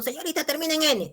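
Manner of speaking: high, quick pupil's objection; student objecting. Acted. Student objects to teacher about the ending, high and quick. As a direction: interject like a pupil correcting the teacher.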